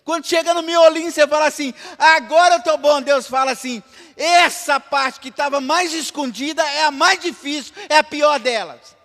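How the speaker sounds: high, loud fervent preaching; preaching at full force. Acted. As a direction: drive it home to the church. (pregação inflamada e alta)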